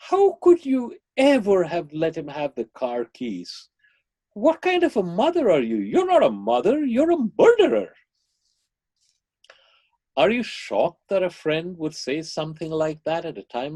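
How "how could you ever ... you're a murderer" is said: high, rising indignant condemnation; outraged accusation. Retold or imitated. Friend to the mother after the accident, outraged blame. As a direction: build to a loud, scandalized final accusation.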